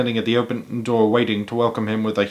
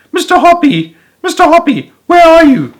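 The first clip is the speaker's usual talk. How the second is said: much higher, louder excited yell up from below; shouting; 8 dB louder than usual. A narrator's reading. Lady shouting up to her neighbour, shrill and excited. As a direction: call up to him, thrilled and loud. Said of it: "she was shouting".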